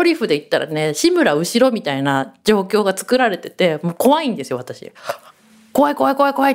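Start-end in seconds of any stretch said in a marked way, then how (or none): 5.7-6.5 scared whimpering. Retold or imitated. Retelling terror at the movie.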